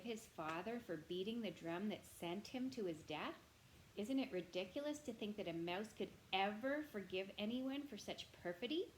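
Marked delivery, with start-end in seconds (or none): none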